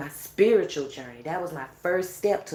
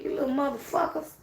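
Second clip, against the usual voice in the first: retold cussing out; 8 semitones higher, faster than usual. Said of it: "I had done cussed their ass out".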